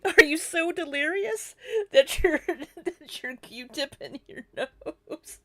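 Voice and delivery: high-pitched question broken by laughter; real giggling fit, words falling apart; laughing. Real, not acted. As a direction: lose it laughing halfway through the question. Laughing at the clip.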